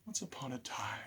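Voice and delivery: soft whispered story opening; storyteller's hushed once upon a time. A narrator's reading. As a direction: whisper it to draw the children in. Opening the story read aloud.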